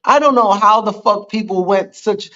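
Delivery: ordinary talk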